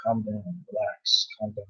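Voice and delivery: very soft, low murmur; hushed, low, soothing self-talk; calming self-talk. Acted. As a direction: mutter it under your breath to settle yourself. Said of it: "should tell himself to relax".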